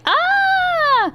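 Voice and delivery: high-pitched squealed "ah"; high wail. Pretend. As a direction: let out a long, high mock wail. Mock wail over blanking on accent.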